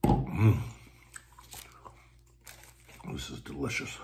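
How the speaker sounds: talking with mouth full; muffled by food in mouth; chewing while talking. Real, not acted. Seen 1: Jaw working, head down while chewing; sandwich in hand from 3.1.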